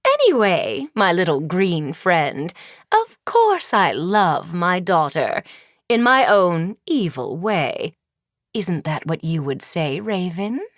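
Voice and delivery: haughty wicked queen sneer; scornful evil queen. Acted. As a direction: look her daughter up and down with contempt.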